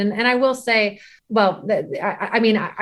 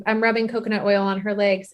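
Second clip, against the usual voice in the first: ordinary talk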